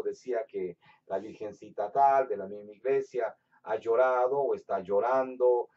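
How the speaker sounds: ordinary talk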